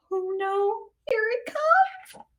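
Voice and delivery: ordinary delivery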